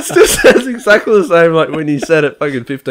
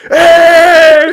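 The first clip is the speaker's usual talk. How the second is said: sudden very high, loud shouted Hey; really loud Hey; 7 dB louder than usual. Retold or imitated. Friend breaking a minute of phone silence with a loud high Hey. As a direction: burst out with Hey after a long silence. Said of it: "Like, really loud".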